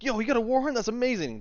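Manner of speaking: high, thrilled gushing; overjoyed fan. Acted. Grabbing at an adventurer's war horn, overjoyed. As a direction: marvel like a starstruck fanboy. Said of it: "like, the happiest person on the planet".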